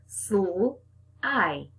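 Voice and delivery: Sl-i-c-e with each sound drawn out; split apart. Shown as a demonstration. Teacher breaks the word apart for the children. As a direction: stretch Sl-i-c-e into separate sounds. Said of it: "Break apart that sl".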